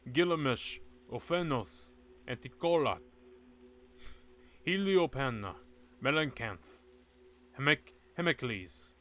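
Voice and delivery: loud, grand chanting of divine names; solemn invocation. Acted. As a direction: call each god's name like a priest. Invoking god names.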